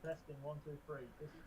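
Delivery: faint mic test in hiss; mic check too quiet to hear; quiet voice loud hiss. Real, not acted. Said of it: "you're as quiet as fucking".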